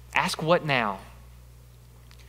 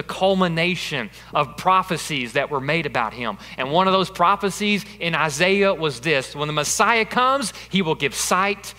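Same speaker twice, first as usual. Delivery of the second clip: louder, higher preacher's build, pressing the point home; preaching at full force; 5 semitones higher, 6 dB louder than usual. Acted. Preacher urging the church to ask what now, full force. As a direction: drive it home to the congregation.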